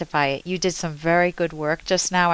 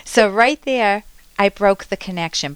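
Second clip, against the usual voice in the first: ordinary talk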